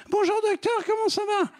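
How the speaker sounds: very high, far-up squeaky greeting; operated high voice. Acted. Patient greets doctor a year after surgery, squeaky high. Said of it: "elle s'est fait opérer".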